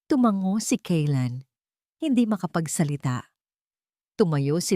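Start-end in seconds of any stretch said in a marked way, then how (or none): none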